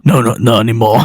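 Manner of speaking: low, deflated character voice; downcast reply. Acted. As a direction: answer as if let down. Acted downcast answer to question.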